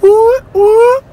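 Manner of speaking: high, loud falsetto whoop; excited whoop. Real, not acted. Vlogger cheering over his food, excited whoop. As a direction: let out a giddy cheer.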